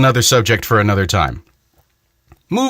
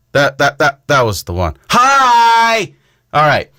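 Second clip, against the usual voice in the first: usual speaking voice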